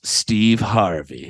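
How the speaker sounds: low, slow, proud reveal; drawn-out Steve Harvey, pleased with herself; drunk lady's answer. Retold or imitated. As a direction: lean into the name slowly, sure it will land. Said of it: "No, she goes".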